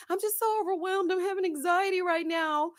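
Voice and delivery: high-pitched imitation of tearful overwhelm; retold high, upset plea; mock crying. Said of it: "she's crying, telling him".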